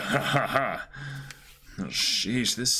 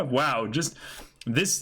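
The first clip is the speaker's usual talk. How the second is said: normal talking voice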